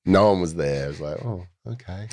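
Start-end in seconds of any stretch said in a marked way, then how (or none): 1.2-2.1 soft, higher, let-down little "oh, okay"; a quiet, deflated shrug of a reply. Retold or imitated. Retelling his flat reaction.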